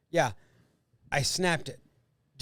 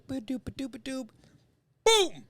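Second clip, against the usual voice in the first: toy sound effects; 9 semitones higher, slower than usual. Retold or imitated. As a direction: make the toy noises, then burst out with the snap. Imitating toy sounds while snapping it.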